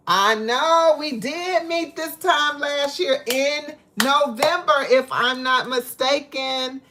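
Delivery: high, loud and slow; excited. Real, not acted. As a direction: celebrate the year together, hyped. Celebrating a year with a viewer.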